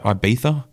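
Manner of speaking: Ibiza? as ee-BEE-tha, questioning rise; Spanish-style Ibiza. Shown as a demonstration. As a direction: try Ibiza? with a lisped th, unsure. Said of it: "I have a lisp if I say it like that".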